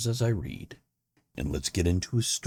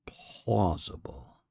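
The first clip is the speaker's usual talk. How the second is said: whisper; much slower than usual. Acted. Whispered word in intro.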